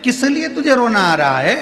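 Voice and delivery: high-pitched, puzzled demand; insistent questioning. Acted. Ram asking the widow why she weeps, insistent.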